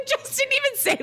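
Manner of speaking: high, laughing disbelief. Real, not acted. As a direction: laugh through the words. Laughing at being unmatched.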